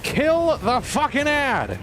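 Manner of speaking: high, slow annoyed yell; annoyed shout. Real, not acted. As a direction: snap at the ad in annoyance. Gamer annoyed by an ad.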